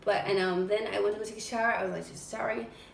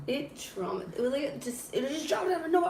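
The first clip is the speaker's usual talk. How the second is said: ordinary talk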